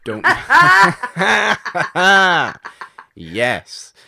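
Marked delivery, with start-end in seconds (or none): 0.3-3.1 loud, high burst of laughter; big laugh. Real, not acted. Co-host reacting to a closet joke, loud real laughter. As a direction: crack up loudly, long and high.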